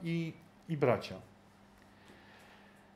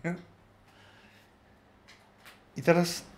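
ordinary talk, nothing marked

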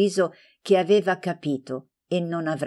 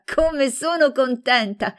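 much higher, slower, overjoyed; delighted squeal; 8 semitones higher, 3 dB louder than usual. A narrator's reading. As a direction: let the joy peak on "sono". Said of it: "fece grandi feste".

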